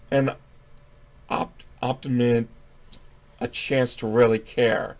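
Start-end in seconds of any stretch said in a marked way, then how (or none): none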